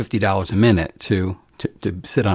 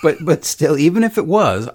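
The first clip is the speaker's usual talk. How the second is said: high, animated insistence; emphatic pushback; 8 semitones higher, 5 dB louder than usual. Real, not acted. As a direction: jump in excited.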